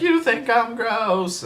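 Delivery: high, slow, wounded whine; hammed-up hurt wife voice. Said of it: "they'd be like".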